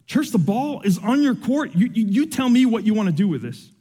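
ordinary talk, nothing marked

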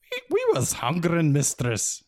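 a small blue man owning up; sheepish wee Feegle. A narrator's reading. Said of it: "he muttered".

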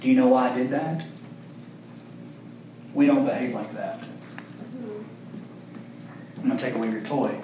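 soft, low, gentle parent voice; fatherly correction. Retold or imitated. God correcting his son like a parent, soft and gentle. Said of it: "he's looking at him and he's going".

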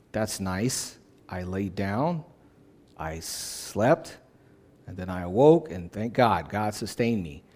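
low, softly musing, untroubled recital; casual complacency. Acted. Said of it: "when we think of that, we say".

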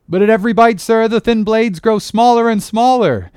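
high, loud, anxious objection; alarmed protest. A narrator's reading. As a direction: call out a worried warning to the captain. Oarsman protesting to captain.

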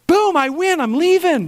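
high, playful gloat; mic-drop gloat, high; mock triumph. Real, not acted. Pastor jokes to the congregation, high playful gloat.

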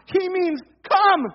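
"come" drawn out high and loud; emphatic stress. Real, not acted. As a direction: punch "come" as a firm command.

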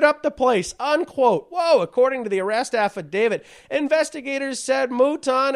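reciting a news article, pitch lifted; news read. Real, not acted. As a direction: read the report aloud to the audience. Reads out a news report.

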